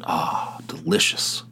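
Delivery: a dreamy whispered "delicious"; savoring whisper. Real, not acted. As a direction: breathe the word "delicious" like it's heaven. Savouring the Cuban sandwich.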